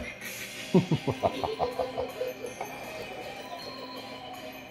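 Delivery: shrill breathy cackle; evil cackle. Acted. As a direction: cackle like a madman. Villain cackle.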